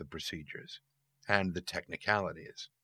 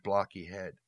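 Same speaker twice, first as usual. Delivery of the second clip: ordinary delivery, nothing marked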